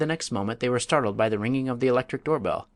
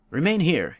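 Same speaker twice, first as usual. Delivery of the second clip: higher, stern command to the captive; commanding bandit; 7 semitones higher, 4 dB louder than usual. A narrator's reading. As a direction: order her to stay put. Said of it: "he commanded".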